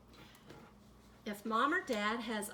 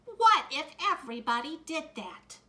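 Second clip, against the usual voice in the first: stern scolding; 5 semitones higher, 9 dB louder than usual. A narrator's reading. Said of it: "glared at me over her glasses".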